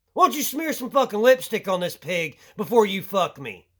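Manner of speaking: ordinary delivery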